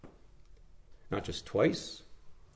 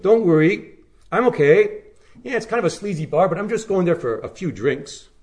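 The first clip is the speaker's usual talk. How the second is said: higher and louder, carelessly cheerful; mock breezy excuses; 5 semitones higher, 4 dB louder than usual. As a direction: brush off every danger lightly. Mocking careless believer.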